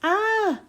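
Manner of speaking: high startled ah; a sudden cry on waking into traffic; startled yell. Acted. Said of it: "McQueen yelled".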